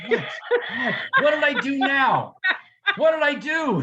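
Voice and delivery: high, laughing what-did-I-do; mock innocent protest. Husband to wife, feigning innocence, laughing. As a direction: play dumb with a laugh. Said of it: "I'm like".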